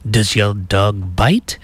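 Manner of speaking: slow mock French accent; lightly voiced French detective, drawn-out words; Inspector Clouseau. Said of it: "Inspector Clouseau says to".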